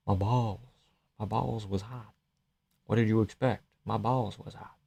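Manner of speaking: quiet, lightly voiced Derek Lewis; Derek Lewis impression. Streamer trying a quiet Derek Lewis line for chat. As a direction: mumble it low like Derek Lewis. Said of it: "Race accurate".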